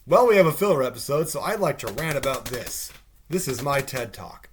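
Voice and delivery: mock self-important speech; mocking writers. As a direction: imitate a writer announcing a rant. Mocks writers shoehorning a rant.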